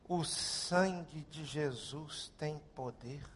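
retold faint cry from the soul; desperate hushed prayer. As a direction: murmur it as the only words left. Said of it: "só soube dizer assim".

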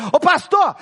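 mock loud greeting, pitched high; loud high call. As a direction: call out to the pastor brightly and loud. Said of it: "chegar e falar assim".

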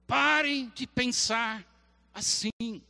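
voice of God. Acted. God telling the overthinker to stop, stern and slow. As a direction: slowly order the thinker to stop. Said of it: "A voz de Deus na sua vida".

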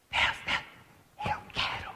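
breathy pleading whisper; whispered childlike plea. Retold or imitated. Believer begging God like a toddler, whispering. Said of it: "como uma criança de três anos".

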